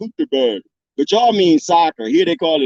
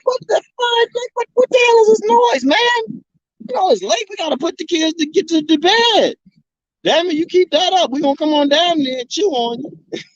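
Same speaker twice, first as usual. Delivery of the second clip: annoyed hill animals; 11 semitones higher than usual. Retold or imitated. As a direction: squeak it out like a fed-up critter. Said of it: "the animals in the hills like".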